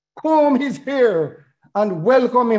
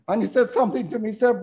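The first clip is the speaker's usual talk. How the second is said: ordinary talk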